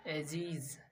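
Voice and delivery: एजीज ending in a buzzed z; voiced S demo. Shown as a demonstration. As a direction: say एजीज with the final S as z. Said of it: "जौ का".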